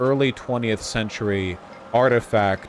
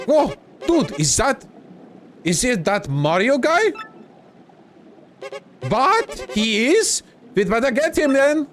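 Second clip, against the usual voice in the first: high, drawn-out cartoon minion voice; villain henchman; 14 semitones higher, 3 dB louder, slower than usual. Henchman spotting intruders and that Mario guy, high startled voice. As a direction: play a startled minion way up in pitch.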